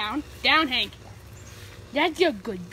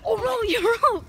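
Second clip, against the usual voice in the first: high, slow, laughing squeal of surprise; delighted laughing shout; excited laughing cry; 9 semitones higher, 4 dB louder than usual. Real, not acted. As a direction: burst out laughing as your friend makes it up. Friend climbs onto shed roof.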